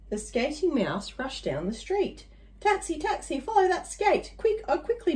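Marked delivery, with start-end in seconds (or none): 2.6-5.1 high, urgent hailing; frantic. Acted. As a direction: wave down the cab in a panic. Hailing a taxi in panic.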